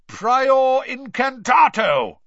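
loud, drawn-out spell cry; roaring Mister Diggory. A narrator's reading. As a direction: roar the incantation high and slow. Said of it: "roared Mister Diggory".

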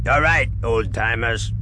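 lightly voiced surly guard; churlish guard. A narrator's reading. Guard releasing two old prisoners, mildly churlish. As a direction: announce it with a touch of churlishness. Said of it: "the guard announced churlishly".

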